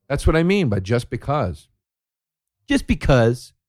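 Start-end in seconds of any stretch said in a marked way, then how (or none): 2.7-3.5 mock dim blogger.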